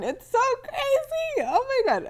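giddy shriek of disbelief at being twenty-one; real excited squeal. Real, not acted. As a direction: let it burst out in a giddy laughing squeal. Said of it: "I'm freaking out".

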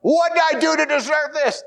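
retelling sliding into the valley, crying out; exasperated cry. Retold or imitated. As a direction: throw it up to heaven in comic despair. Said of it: "I'm saying".

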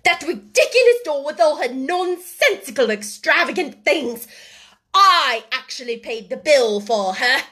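indignant rant. A narrator's reading. Headmistress rants about the costly birthday doll, indignantly. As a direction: pour scorn on the extravagance.

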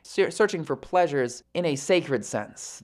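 ordinary talk

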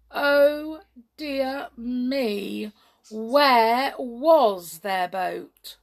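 suspenseful, lamenting narration; dramatic dismay. A narrator's reading. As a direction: stretch each word in storyteller's alarm. Narrator dramatizes the missing boat.

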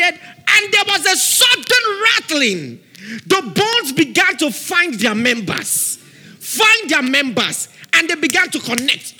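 loud, high fiery preaching; preaching at full force. Real, not acted. Preacher declares a shaking over the congregation, loud and fiery. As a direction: drive it home with rising fire.

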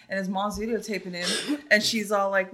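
ordinary delivery

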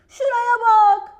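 high, loud excited shout. A narrator's reading. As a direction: call out, pointing something out. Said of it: "diye bağırdı gece".

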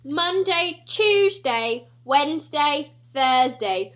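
mock British accent, building to loud; hammed-up British accent breaking into laughter. Listing the weekdays for a co-host, mock British accent. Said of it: "our renditions of British people".

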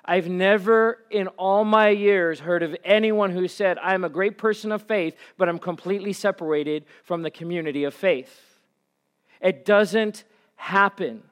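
loud, high, driving sermon voice; fiery preaching. Acted. Camp pastor preaching to the church, loud and fired up. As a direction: build the crowd up to the rafters.

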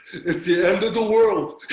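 loud, laughing mock panic; mock freak-out. Preacher imitates himself watching the news, mock freak-out. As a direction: play up the panic, half laughing. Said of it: "freaking out".

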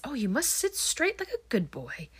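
Mummy straightens the doll, doting but prim; prim mother voice. Retold or imitated. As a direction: coo like a strict old mother to her boy. Mother fussing over the doll.

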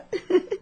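put-on a short amused girlish laugh; light laugh. A narrator's reading. Girl laughs at flustered suitor. (risada leve e divertida)

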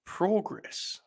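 "progress" soft and high, first vowel as "praw"; odd-vowel progress. Shown as a demonstration. Host puzzling over the word, soft high "PRAW-gress". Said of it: "Why is it an A".

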